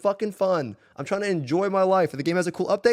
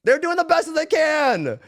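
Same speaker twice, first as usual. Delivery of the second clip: higher, louder insistence; loud fired-up defence; emphatic; 7 semitones higher, 4 dB louder than usual. Real, not acted. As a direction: drive the point home hard. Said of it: "defending the developers, fired up".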